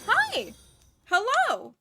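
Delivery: high, loud happy hello; excited greeting. Real, not acted. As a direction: greet them brightly. Greeting a raider.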